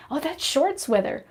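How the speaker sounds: hammed-up cheerful northerner; mocking cold-lovers. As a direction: gush like someone who loves freezing weather. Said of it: "they're like".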